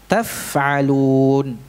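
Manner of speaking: slow "taf'alun" with the f sounded; slow teacher demo of "taf'alun"; correct recitation. Shown as a demonstration. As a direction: say "taf'alun" slowly and carefully. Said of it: "Ya, taf'alun".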